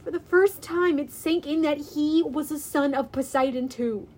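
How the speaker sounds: ordinary delivery, nothing marked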